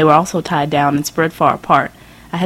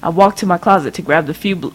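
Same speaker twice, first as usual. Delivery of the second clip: ordinary delivery, nothing marked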